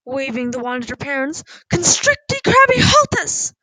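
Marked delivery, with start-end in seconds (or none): 1.7-3.5 loud, high-pitched spell-casting cry; furious shout. A narrator's reading. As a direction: fire out the spell with fury. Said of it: "Poppy shouted".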